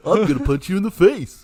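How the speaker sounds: high, loud cartoon Goofy voice; mock Goofy punch threat. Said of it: "Goofy's like".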